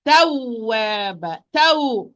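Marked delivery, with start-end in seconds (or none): 1.5-2.1 taw pushed higher and louder on the doubled waw; nabr stress. Shown as a demonstration. Teacher shows stress on the shadda waw. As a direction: give taw a push of the sound. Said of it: "I'm raising my voice a little bit".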